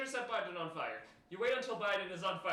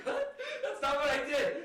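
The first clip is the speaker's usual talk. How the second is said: higher, louder, slow, laughing through it; cracking up while reading the line; 7 semitones higher, 5 dB louder, slower than usual. Real, not acted. Reading absurd game text, cracking up.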